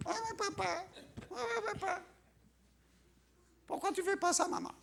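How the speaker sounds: high-pitched mock baby whine; whiny baby. Grown believer with a baby bottle whining to papa and maman, mock baby voice. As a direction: plead like a little child to its parents.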